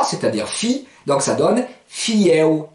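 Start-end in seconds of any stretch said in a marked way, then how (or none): 1.9-2.7 "fieo" slow, in parts: fi-éo; slow demonstration. Shown as a demonstration. Teacher builds the word from its vowels, slow "fieo". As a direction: separate "fi" from the "éo" glide. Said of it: "Si je le prononce vite".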